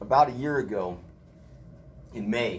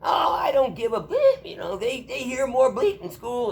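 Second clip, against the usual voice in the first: high, loud, slow mock of a cranky old woman; imitating old mother; 12 semitones higher, 7 dB louder than usual. Son mimicking his swearing mother on Bluetooth, high and loud. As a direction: squawk like his cursing mom. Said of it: "she's like".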